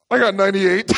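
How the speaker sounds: loud, high triumphant brag breaking into a laugh; victorious cackling boast; gloating laugh. Retold or imitated. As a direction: rub it in his face, then crow with laughter. Gloating over rival's low score.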